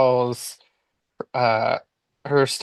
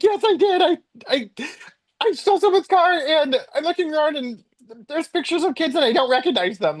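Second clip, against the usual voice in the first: comic panic, pitched way up; retold meltdown; 14 semitones higher than usual. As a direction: stammer out a mock-frantic confession. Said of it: "having a little meltdown on the phone".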